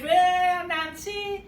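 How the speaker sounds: high, loud cheering shout; cheering call. Acted. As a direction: cheer him on from below. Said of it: "waving and cheering".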